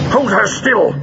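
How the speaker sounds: sharp high-pitched order; commanding. A narrator's reading. Villain to henchmen restraining his attacker, commanding. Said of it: "he commanded".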